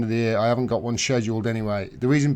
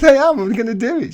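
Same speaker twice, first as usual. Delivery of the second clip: higher, louder insisting; insistent; 9 semitones higher, 7 dB louder, faster than usual. Retold or imitated. Host retelling arguing with girlfriend, playful insistence. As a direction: push back, playfully defiant. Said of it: "I said".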